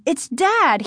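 high, urgent protest; exasperated high-pitched retort; angry insistence. A narrator's reading. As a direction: insist crossly. Margaret argues angrily with brother.

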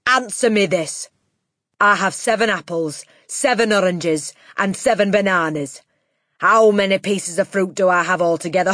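loud, forceful quizzing; Trunchbull. A narrator's reading. Headmistress testing a boy with fruit, loud and domineering. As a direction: set out the sum loudly, item by item.

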